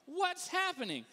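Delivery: high, slow, alarmed question; mock panic. Dad with kids in the car, panicking. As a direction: stretch it out in rising alarm.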